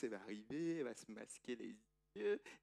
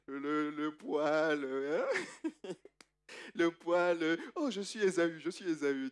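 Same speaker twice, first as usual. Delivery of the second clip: mocking tease; 4 semitones higher, 11 dB louder, slower than usual. Mocking Jacob's old trick.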